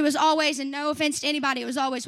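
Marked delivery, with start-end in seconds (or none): none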